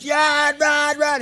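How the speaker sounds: high, loud urging to run; frantic acted-out cheering from the sidelines; excited yelling. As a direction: yell him down the base line. Uncle urging nephew to run.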